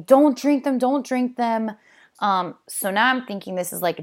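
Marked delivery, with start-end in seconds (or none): 0.0-1.7 urgent warning. Retold or imitated. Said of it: "smashing his glasses out of people's hands and saying".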